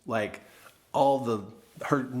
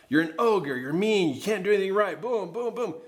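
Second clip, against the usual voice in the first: higher-pitched accusations, stacked one on another; piling-on inner critic; 6 semitones higher than usual. Acted. Bricks stacking in his head, acted self-accusation. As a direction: pile on each insult like a brick. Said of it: "feel the bricks getting stacked".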